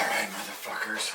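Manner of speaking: breathy whisper, motherfuckers under the breath; whispered curse. Real, not acted. Tired hiker in his hotel room, whispered curse. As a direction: swear it under your breath.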